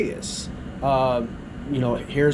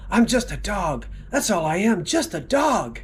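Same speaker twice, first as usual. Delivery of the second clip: higher plaintive patient voice; 6 semitones higher than usual. Acted. Patient telling psychiatrist he is a dog, high and plaintive.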